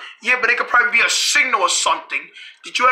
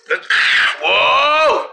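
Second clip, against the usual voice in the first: normal talking voice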